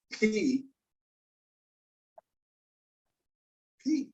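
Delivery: P with a puff of air; aspirated P. Shown as a demonstration. Teacher has students feel the puff, aspirated P. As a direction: let a little puff of breath out on the P. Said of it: "you should feel a little bit of a puff".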